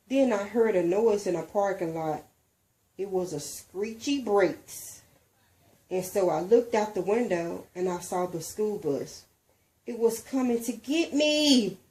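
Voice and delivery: ordinary delivery, nothing marked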